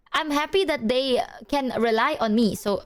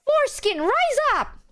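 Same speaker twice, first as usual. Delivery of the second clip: very high mock rallying cry; squealed impression of mom's battle cry; loud proclamation; 14 semitones higher than usual. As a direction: imitate a loud public chant, pitched way up. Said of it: "She said it so loudly".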